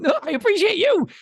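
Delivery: high, laughing through the words; a giggly high-pitched retort; laughing reply. Retold or imitated.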